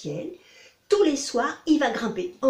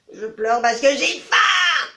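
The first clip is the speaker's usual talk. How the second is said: cri lent du renard affamé; hurlant; 5 semitones higher, 9 dB louder, slower than usual. Acted. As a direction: hurle sa faim en traînant. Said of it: "dit-il en hurlant". (renard qui hurle lentement)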